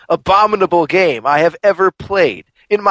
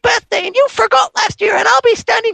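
very high falsetto little sister, demanding; bratty little sister. Retold or imitated. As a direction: squeak it up like a spoiled little girl. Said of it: "she's like".

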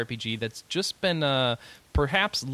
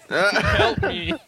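high, whiny cry for help; mock plea; 13 semitones higher, 7 dB louder than usual. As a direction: beg the hotline, hammed-up.

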